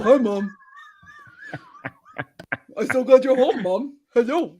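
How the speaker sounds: dopey dog voice, laughing; slack jawed. Pretend. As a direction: play a dim, happy dog. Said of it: "he's a little dopey like this".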